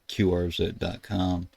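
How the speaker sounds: normal talking voice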